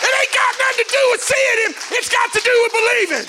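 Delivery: high, loud shouted preaching; full-force preaching. Acted. Preacher declaring to the demons in the community, shouting. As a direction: preach it hard over the music.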